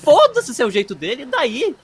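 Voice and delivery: high, loud comic outburst; exasperated retort. Acted. Grandchild fed up with grandma's excuse, exasperated mock outburst. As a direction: snap back with mock indignation.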